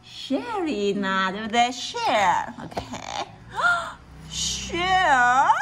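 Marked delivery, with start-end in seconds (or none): 2.9-4.2 high sharp surprised gasp; little mouse gasp. Acted. Mouse gasps at the idea. 4.3-5.5 hopeful mouse. Acted. Mouse proposes sharing.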